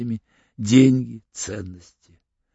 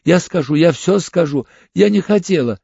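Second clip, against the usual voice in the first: higher, distressed confession; near-crying outburst; 5 semitones higher, 4 dB louder than usual. A narrator's reading. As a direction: plead as if tears are coming. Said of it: "И она заплакала, почти закричала". (почти плача, срываясь на крик)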